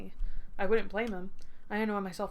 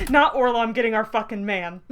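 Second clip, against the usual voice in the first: loud fake indignation; mock outrage; 8 dB louder than usual. Real, not acted. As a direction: rage at the rival in jest.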